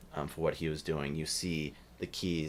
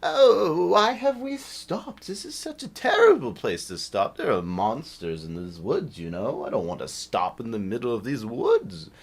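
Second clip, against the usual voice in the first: fretful bird person; 6 semitones higher, 10 dB louder than usual. Acted. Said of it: "bird person says".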